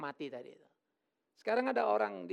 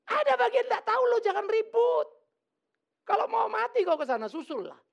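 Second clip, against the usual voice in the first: high, loud teasing rebuke; a hammed-up loud telling-off; mock scolding shout; 13 semitones higher, 8 dB louder than usual. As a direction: shout him down for fun. Preacher scolding mourner, half joking.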